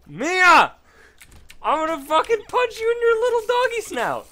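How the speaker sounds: very high, loud strained screech; mock rage scream. Owner woken again, screaming in mock rage at the dog. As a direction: shriek a threat in cartoon fury. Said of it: "mock rage at dog".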